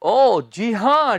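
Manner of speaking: ordinary talk, nothing marked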